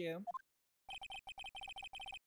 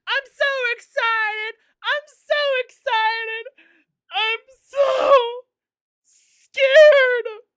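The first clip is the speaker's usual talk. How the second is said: very high, loud, drawn-out mock wail; hysterical TV clip imitation; 14 dB louder, much slower than usual. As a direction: build to a hammed-up hysterical shriek. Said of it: "where it's like".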